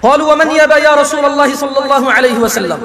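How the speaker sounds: slow, measured Arabic recitation; Arabic hadith recitation. Acted. Preacher reciting hadith to congregation, slow devout recitation. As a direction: chant the hadith slowly and devoutly.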